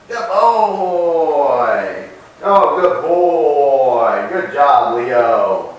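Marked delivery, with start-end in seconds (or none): 0.1-4.3 drawn-out, louder praise; praising the dog. Real, not acted.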